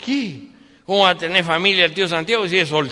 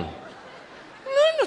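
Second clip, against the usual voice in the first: high squeak. Acted. Incredulous relative's reply.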